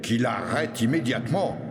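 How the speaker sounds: Robert indigné, un peu plus aigu; le forgeron s'offusque qu'on le chatouille; exclamation de Gros Robert. Acted. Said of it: "s'écria Gros Robert". (forgeron outré qui s'écrie)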